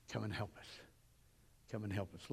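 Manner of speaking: soft, low appeal; hushed plea. Acted. Preacher voicing the call for help, hushed plea. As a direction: let it drop to a quiet, low plea.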